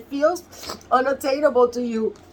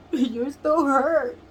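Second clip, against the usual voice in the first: tearful, slow; much slower than usual. Real, not acted. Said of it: "I'm so emotional".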